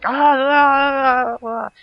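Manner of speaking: loud, high imitation scream, held long; mock agonised scream. Man covered in spiders as veins turn purple, mock agonised scream. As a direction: scream it out in hammed-up agony.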